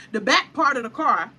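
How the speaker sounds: ordinary talk, nothing marked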